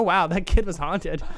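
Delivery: ordinary delivery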